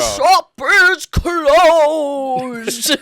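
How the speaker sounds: high, very loud British proclamation; hammed-up drawn-out bellow; Ian McKellen. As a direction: belt it out slowly like a mock stage knight. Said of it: "an Ian McKellen accent".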